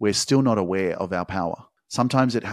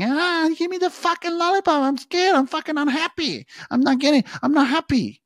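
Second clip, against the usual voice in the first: put-on screaming kid tantrum. Podcaster mimics a kid denied a lollipop, screaming tantrum. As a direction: throw a hammed-up screaming tantrum like a small kid. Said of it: "starts fucking screaming".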